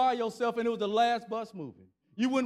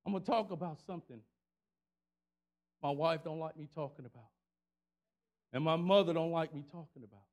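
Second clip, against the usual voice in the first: ordinary talk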